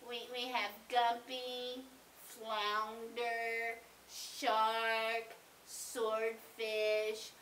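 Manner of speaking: put-on low, droning teen on the phone; teenage girl. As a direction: drag out the menu like a listless teenager. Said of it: "annoying little teenager-y girl".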